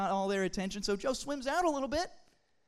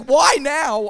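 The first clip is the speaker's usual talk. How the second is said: louder, desperate cry; frantic outburst; 3 semitones higher, 13 dB louder than usual. Retold or imitated. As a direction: throw the question out in despair. Joe freaking out at the floating poop.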